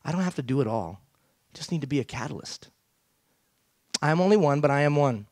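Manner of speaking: ordinary talk, nothing marked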